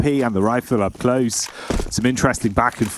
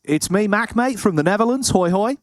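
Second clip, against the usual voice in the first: mock Aussie accent; 9 semitones higher than usual. Mock Aussie-Dutch caller voice.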